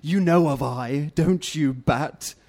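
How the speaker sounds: low rumbling duck ruler. Acted. Red Duck questioning the bat, rumbling. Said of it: "her voice rumbled".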